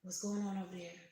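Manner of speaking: breathless whispered peek around the corner; curious little page whispering. Pretend. Reader voicing the peeking page card, a whisper. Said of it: "you see this little cute little page peeking".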